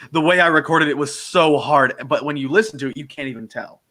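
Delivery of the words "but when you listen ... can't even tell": ordinary talk, nothing marked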